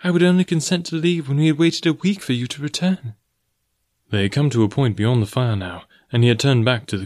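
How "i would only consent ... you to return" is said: high-pitched woman's voice; earnest young heroine. Acted. Girl explains to the forest man why she left, earnestly. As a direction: plead your case in a high voice.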